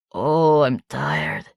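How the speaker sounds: low, drained, exhausted sigh; weary confession. A narrator's reading. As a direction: let the tiredness sink in. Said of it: "confessed Monsieur Spitz".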